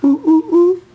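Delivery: loud high monkey hoots; monkey noise. Shown as a demonstration. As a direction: hoot like a monkey for the child. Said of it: "what does a monkey do".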